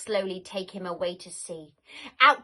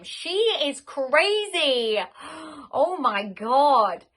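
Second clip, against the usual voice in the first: excited outburst; 4 semitones higher, 5 dB louder, slower than usual. Real, not acted. Listener reacts to the story.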